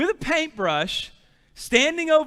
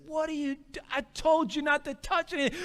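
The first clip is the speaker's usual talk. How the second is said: higher, strained mock exasperation; frustrated scolding; 6 semitones higher, 4 dB quieter than usual. Dad finding his son covered in paint, frustrated scolding. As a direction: scold the child in retold disbelief. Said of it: "I was so frustrated".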